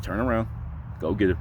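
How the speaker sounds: low, soft voice of conscience; quiet prompt from the back of the head; little inner voice. Retold or imitated. Voice in his head urging him to turn back, low and soft. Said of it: "a little voice clear as day".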